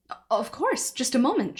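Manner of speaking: put-on over-polite for a teenager; too respectful. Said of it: "much too respectful tone".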